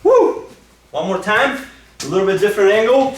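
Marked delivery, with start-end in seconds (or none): 0.0-0.5 high-pitched celebratory "woo"; short, high burst of excitement; excited whoop. Real, not acted. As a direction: let out a real whoop after the throw. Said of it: "Sorry, I get excited, so I gotta do the final no-, noise at the end".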